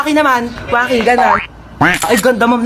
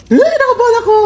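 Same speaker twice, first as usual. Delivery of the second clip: high-pitched startled yelp; mock panic; 11 semitones higher than usual. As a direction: yelp in alarm at the water. Panics in shallow water.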